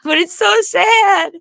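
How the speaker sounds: high, slow, put-on pitiful voice; mock sad voice. Mocking someone's sad whine, high and slow. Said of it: "It's like".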